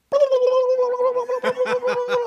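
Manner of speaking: high, loud rapid babble noise; mouth-made sound of a phone blowing up; imitated notification frenzy. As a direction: trill a long burst of high noise.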